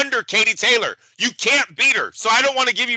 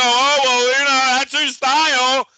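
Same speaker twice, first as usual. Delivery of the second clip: mock whiny excuse, half-hearted; 5 semitones higher, 3 dB louder than usual. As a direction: sneer at the fighter's excuse, lightly whining. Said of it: "You don't go".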